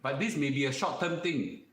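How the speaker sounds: ordinary talk, nothing marked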